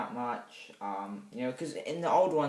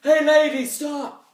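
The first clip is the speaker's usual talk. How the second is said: much higher, louder mock shout at a passer-by; calling out; 14 semitones higher, 13 dB louder, slower than usual. Reviewer acting the unhurt hero shouting at a lady, loud and high. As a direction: yell after her to stop.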